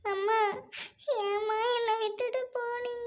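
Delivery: tearful lament. Acted. Orphaned girl alone, calling on God and her lost mother, crying. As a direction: sob out a lonely lament between gasps. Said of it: "தனியா நின்னு ஏங்க அழுகுறீங்க?".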